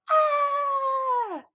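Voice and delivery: high, long frantic scream; panicked kid's scream; child scream. A narrator's reading. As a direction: shriek in panic. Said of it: "my screams".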